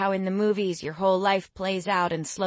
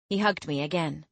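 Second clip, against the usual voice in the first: usual speaking voice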